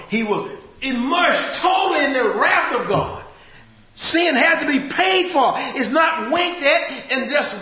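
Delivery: loud, high preacher's cry; rousing sermon climax; full-force preaching. Acted. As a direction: hammer the wrath home from the pulpit.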